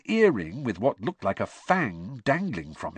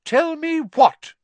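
slow, high, loud challenge; sharp demand; 9 dB louder, slower than usual. A narrator's reading. Mother walking in on the threat, demanding suspiciously. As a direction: cut in, demanding an answer.

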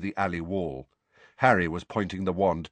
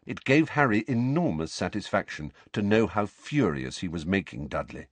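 ordinary delivery

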